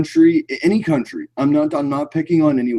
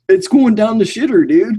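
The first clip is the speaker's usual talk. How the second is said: ordinary delivery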